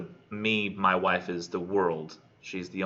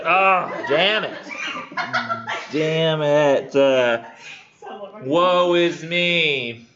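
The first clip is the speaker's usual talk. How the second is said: loud, high, drawn-out lament; mock despair; 7 semitones higher, 6 dB louder, much slower than usual. Knight learns he must marry the hag, loud mock-tragic lament. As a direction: wail it with hammed-up woe.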